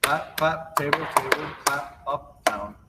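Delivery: picking up the rhythm after the slow run. Shown as a demonstration. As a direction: speed the calls up a bit. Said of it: "A little faster".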